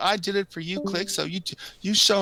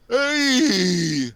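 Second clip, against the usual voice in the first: a short raised "Hey!" in a bad Donnie voice; Donnie impression; 6 semitones higher, 3 dB louder than usual. Friend attempts a Donnie line on request, mock shout. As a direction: bark out a quick mock greeting. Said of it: "let me think of a line Donnie says".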